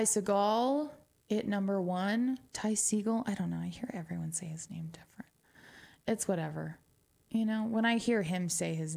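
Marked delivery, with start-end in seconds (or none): 3.6-5.3 quick whispered aside; fast confiding whisper. Real, not acted. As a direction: rush it out under your breath. Aside about pronunciation.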